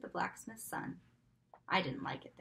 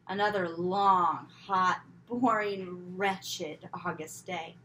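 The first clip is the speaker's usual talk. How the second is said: slow, louder, stretching each word in misery; drawn-out complaint; 7 dB louder, slower than usual. A narrator's reading. Girl groaning about another dull hot day, drawn out slowly. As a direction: drag it out like a groaning child. Exaggerated weary repeat.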